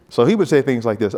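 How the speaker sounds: normal talking voice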